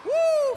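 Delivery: loud, high "woo" cheer; high excited whoop. Real, not acted. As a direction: whoop like a hyped crowd. Cheer after a big jump.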